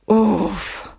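relieved exhale; sigh of relief. A narrator's reading. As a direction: breathe out a big "uf". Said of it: "oddychl si".

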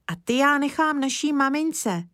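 put-on high, eager child's voice; little boy. Kája explaining to Mr Lesní, high childlike voice. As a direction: speak up like an earnest small boy.

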